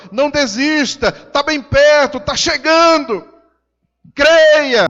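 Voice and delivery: loud, rising exhortation to not give up; preaching at full force. Acted. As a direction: urge them on like a revival preacher. Preaching to the congregation. (pregação inflamada e forte)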